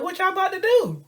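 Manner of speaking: loud, very high comic disbelief; incredulous question. Acted. Man questioning his friend's complaint, incredulous.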